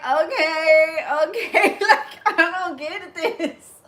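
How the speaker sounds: high, laughing "okay, okay"; laughing helplessly. Retold or imitated. While trainers draw a map, laughing and lost. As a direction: giggle through the confusion. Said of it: "And I was like".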